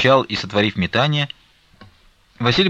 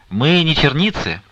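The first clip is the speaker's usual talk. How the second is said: high, drawn-out cheeky retort; loud laughing reply; 7 semitones higher, 4 dB louder, slower than usual. A narrator's reading. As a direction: toss it back slowly with amusement. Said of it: "громко смеясь".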